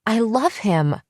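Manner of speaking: screaming. A narrator's reading. Elizabeth screaming that she loves him, upset. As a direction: cry it out at her. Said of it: "She'd never screamed at me before".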